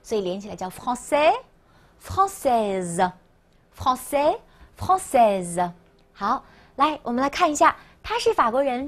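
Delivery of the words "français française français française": "français" ending on a vowel, "française" closing on a Z; final consonant contrast. Shown as a demonstration. Teacher explains the feminine ending, slow "français", "française" contrast. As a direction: slowly alternate silent-S "français" with buzzing Z in "française". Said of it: "S就要发音".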